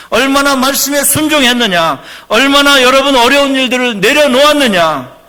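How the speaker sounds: loud, high, impassioned sermon; full-force preaching. Acted. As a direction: drive each line home to the congregation.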